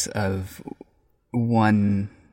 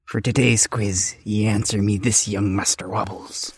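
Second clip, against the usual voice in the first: hearty pirate quiz-master voice. Acted. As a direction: growl it out like a salty pirate captain. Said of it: "International Talk Like a Pirate Day".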